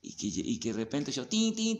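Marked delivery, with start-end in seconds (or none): 1.3-1.8 high ringing tin tin, louder; imitated bell ring. Imitating the guru's bell calling the servant, high and ringing. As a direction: ring out like a little hand bell. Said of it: "la campana sonaba".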